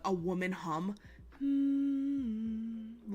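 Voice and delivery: high hummed hmm; eerie woman's hum; imitated hum. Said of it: "hearing a".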